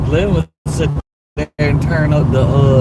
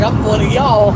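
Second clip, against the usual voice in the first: put-on country accent; 6 semitones higher than usual. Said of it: "I'm gonna be like".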